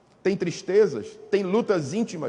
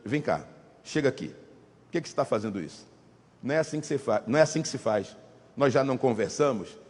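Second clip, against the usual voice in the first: put-on quieter, serious father correcting his child; stern parent scolding; 7 semitones lower, 6 dB quieter, faster than usual. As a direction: scold the kid firmly but low. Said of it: "aplica a disciplina com seriedade". (pai sério repreendendo o filho, voz baixa)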